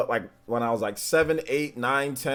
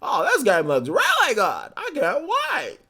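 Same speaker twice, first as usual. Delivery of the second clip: high, drawn-out fake enthusiasm; mock excited gamer; 11 semitones higher, 3 dB louder, slower than usual. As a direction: parody a gushing fan, stretching each word. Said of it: "somebody like that".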